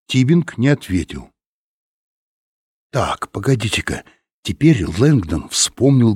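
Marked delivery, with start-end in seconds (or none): none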